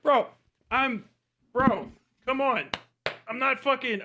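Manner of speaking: ordinary talk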